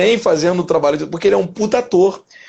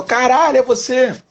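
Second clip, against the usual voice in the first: higher, excited cartoon shout; cartoon character voice; 7 semitones higher than usual. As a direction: pop out a high cartoon greeting. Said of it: "eu gosto de Lado B".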